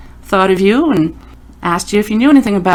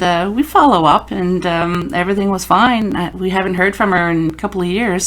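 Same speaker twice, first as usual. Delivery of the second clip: normal talking voice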